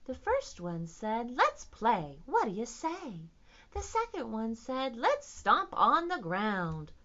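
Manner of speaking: expressive, playful rhyme recital; sing-song read-aloud with varied energy; children's rhyme. Shown as a demonstration. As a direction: act out each line for little listeners.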